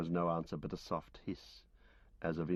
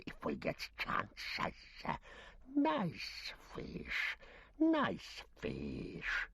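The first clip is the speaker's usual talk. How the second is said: Gollum voice; 5 semitones higher, much slower than usual. Acted. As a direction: croon and snarl like a starved cave creature gloating over fish. Gollum's song by the pool.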